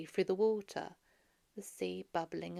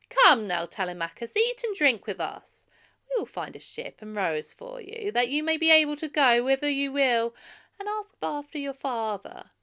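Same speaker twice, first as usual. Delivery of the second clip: coaxing suitor; 6 semitones higher, 9 dB louder than usual. Acted. Suitor Antinous coaxing Telemachus.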